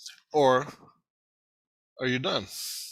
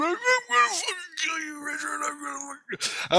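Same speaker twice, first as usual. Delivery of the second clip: high-pitched frantic mock threat; high, strained mock raving; ranting enemy. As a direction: imitate a stuck man screaming threats. Trapped man threatens him, retold.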